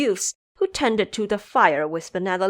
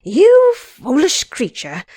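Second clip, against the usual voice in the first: slow, loud, higher scolding curse; cursing; 6 semitones higher, 7 dB louder, slower than usual. Acted. Xing Zhe cursing Ba Jie in slow fury. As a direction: spit out the insult at the pig. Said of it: "Xing Zhe cursed".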